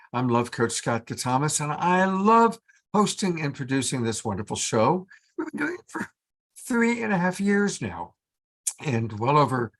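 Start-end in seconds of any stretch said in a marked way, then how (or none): none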